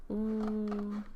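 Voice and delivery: ordinary delivery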